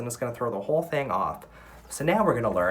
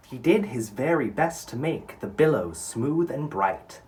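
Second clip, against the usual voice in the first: poem reading; 3 semitones higher, 4 dB louder, slower than usual. Acted. Reads poem aloud to class.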